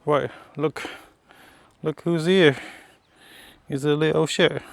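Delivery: high, loud excited greeting; thrilled to see the dog. Real, not acted. Spots the neighbor's dog.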